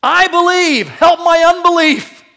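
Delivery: high, very loud desperate shout; crying out. Acted. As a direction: cry it out with full force. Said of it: "this man cries out in response".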